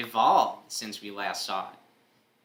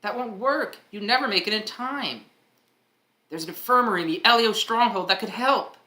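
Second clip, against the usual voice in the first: higher, louder insistent voice; lightly voiced character; 11 semitones higher, 7 dB louder than usual. Character rejects the plan and points to the infirmary, higher and louder. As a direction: object firmly, pitched up.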